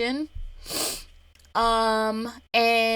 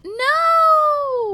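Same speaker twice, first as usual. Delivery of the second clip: shocked no; 5 dB louder than usual. Real, not acted. Friend wipes coffee on sweater.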